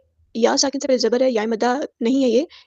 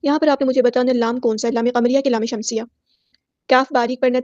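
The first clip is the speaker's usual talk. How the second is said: ordinary talk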